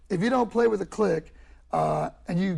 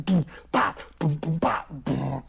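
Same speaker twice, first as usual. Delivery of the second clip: low-pitched mouth drumming; beatbox a dubstep groove, low; imitated kick and snare; vocal dubstep beat; 6 semitones lower, slower than usual. Shown as a demonstration.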